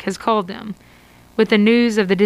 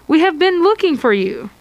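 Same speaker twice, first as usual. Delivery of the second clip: loud, high and urgent; the worried clerk finally finds you; alarmed; 8 semitones higher, 3 dB louder than usual. Acted. Desk clerk rushing to meet you.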